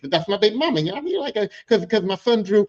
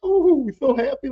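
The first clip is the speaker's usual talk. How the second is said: giddy relief; 7 semitones higher, 5 dB louder than usual. Retold or imitated. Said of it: "It's a laughter like".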